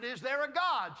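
asked loud and high, leaning in; bold survey question. Retold or imitated. Preacher putting his first survey question to the girl, loud and high. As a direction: put the question to her squarely, loud.

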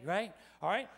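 ordinary talk

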